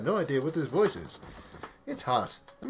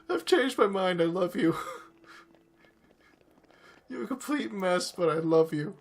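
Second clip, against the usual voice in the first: louder, higher words through tears; tearful; 6 semitones higher, 5 dB louder than usual. Real, not acted. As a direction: say it half crying, overwhelmed. Emotional outburst at the game.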